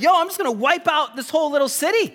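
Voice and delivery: a fed-up God half joking about wiping out the city; God losing patience with a sinning city, mock exasperation; exasperated God. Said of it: "God would get frustrated".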